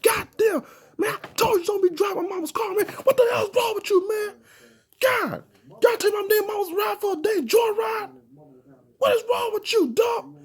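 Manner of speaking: very high, fast, near-crying shout of a furious friend; friend flipping out. Retold or imitated. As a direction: lose it like he's about to cry from rage. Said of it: "he started flipping off".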